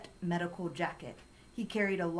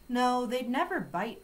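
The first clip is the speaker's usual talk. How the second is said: higher, louder denial; insistent protest; 6 semitones higher, 5 dB louder than usual. A narrator's reading. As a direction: protest firmly in defence of the dogs. Girl protests to alarmed neighbour.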